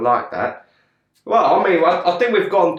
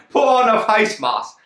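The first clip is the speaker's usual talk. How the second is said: mock geezer; 6 semitones higher than usual. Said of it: "We got face masks, mate".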